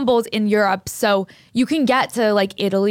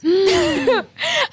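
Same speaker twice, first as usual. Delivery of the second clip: laugh; 10 semitones higher than usual. Real, not acted. Cat owner reacting to the vet bill, high laugh.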